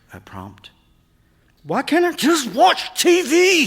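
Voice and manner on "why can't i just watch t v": very high, loud, anguished yell; exasperated wail at full voice; frustrated outburst. A narrator's reading. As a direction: shout it like a kid at breaking point. Frustrated son's outburst in story.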